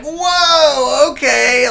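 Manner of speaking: high, loud amazed exclamation; amazed whoa. Retold or imitated. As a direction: gasp it out in amazement. Reacting to impression amazed.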